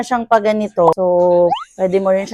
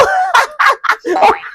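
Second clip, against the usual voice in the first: high burst of shrieking laughter; demonstrated laugh; 14 semitones higher than usual. Shown as a demonstration. Vlogger showing how they look when laughing, demonstrated laugh. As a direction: show off your laugh, high and sudden. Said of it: "pag tumatawa ko".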